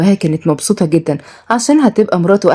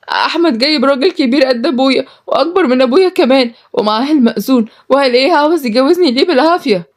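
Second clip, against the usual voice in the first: high, agitated woman's voice; distressed runaway; 6 semitones higher than usual. Acted. Runaway telling of forced marriage, distressed. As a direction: report it in raised-pitch distress.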